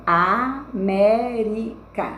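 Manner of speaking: América chopped into slow separate syllables; very slow counting-out of América. Shown as a demonstration. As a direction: say a, mé, ri, ca with gaps for América. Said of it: "eu vou contar as três últimas".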